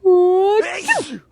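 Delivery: loud mock walrus sneeze; hammed-up creature sneeze. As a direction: sneeze it out big. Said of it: "sneezed Walrus".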